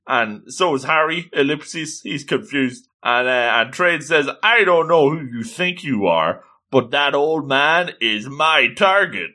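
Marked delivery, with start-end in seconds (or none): none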